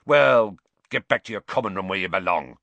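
curt, irritable order; loud start, then brusque dismissal; snapped. A narrator's reading. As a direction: snap it out, dismissing them crossly. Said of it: "snapped Filch".

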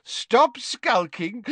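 put-on high, hearty old professor voice; a fond, tipsy potions master; jovial Slughorn. Tipsy teacher praising Harry to Trelawney, high and hearty. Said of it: "hiccuped Slughorn happily".

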